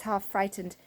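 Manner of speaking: usual speaking voice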